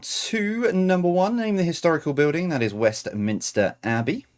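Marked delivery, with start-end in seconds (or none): 0.0-2.5 odd sing-song delivery. Real, not acted. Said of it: "Don't know why I said it like that".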